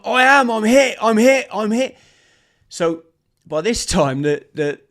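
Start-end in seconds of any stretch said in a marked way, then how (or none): 0.0-2.0 panicked insisting. Retold or imitated. Soldier insists he's shot.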